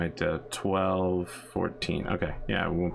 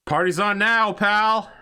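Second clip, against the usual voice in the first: much higher, loud cocky shout; shouted taunt; 14 semitones higher, 8 dB louder, slower than usual. Acted. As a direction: yell it over the comms. Pilot taunts over comms.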